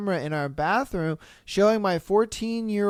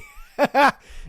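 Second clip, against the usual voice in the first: short, higher burst of laughter; amused laugh; 6 semitones higher, 4 dB louder than usual. Real, not acted. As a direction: crack up briefly at the absurdity. Laughs at the imagined exchange.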